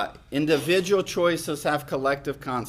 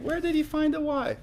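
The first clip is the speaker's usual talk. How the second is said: 1 mock puzzled; 13 semitones higher than usual. Real, not acted. Playful rhetorical question.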